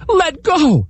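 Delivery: high desperate yell; shouted. Acted. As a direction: shout it at the biting turtle. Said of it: "the man shouted".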